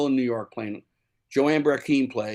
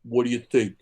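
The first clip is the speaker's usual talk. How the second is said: put-on New York accent; 3 semitones lower than usual. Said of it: "with a New York accent".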